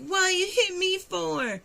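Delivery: high, whiny put-on voice; mimicking him. The boyfriend after being hit, a high mock whine. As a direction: play him as stunned and whining. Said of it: "And he's like".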